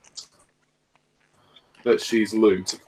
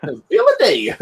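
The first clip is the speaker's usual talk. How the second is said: laughing echo; 11 semitones higher, 5 dB louder, slower than usual. Real, not acted. Echoing excited call, laughing.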